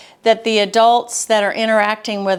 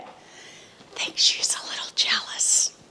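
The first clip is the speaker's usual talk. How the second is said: whispered aside; 9 dB quieter than usual. Real, not acted. Speaker whispering to viewers about her jealous dog, whispered. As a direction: whisper it so the dog won't hear.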